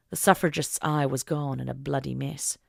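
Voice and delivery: ordinary delivery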